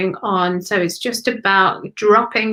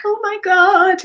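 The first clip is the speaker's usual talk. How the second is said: overwhelmed exclamation; 10 semitones higher, 5 dB louder than usual. Retold or imitated. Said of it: "feels a bit like".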